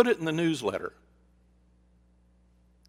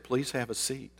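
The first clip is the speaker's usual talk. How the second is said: soft voice; 3 semitones lower, 8 dB quieter than usual. Retold or imitated. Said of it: "I spoke in a very soft voice".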